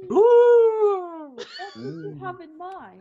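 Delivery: loud, slow, high grand flourish; theatrical fox. Acted. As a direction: ham it up like a showman.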